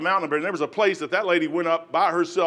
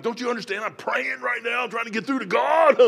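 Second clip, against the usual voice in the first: exasperated protest; 6 semitones higher than usual. Retold or imitated. Praying man to caller as the phone rings, exasperated outburst. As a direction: act out an interrupted man, building to a shout. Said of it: "I'm on my knees praying".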